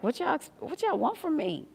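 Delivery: high, mock-fed-up retort; mock exasperated. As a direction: throw up your hands at them, high. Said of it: "So I'm like".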